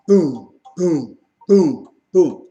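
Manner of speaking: loud, heavy booms like war drums; booming drum imitation. Preacher imitating war drums to the congregation, loud booming. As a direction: pound out each boom like a drum.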